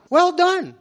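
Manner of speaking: high, bright burst of praise; exclaimed praise. A narrator's reading. King praising his faithful servant, excited exclaim. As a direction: cheer the servant on. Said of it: "the king exclaimed".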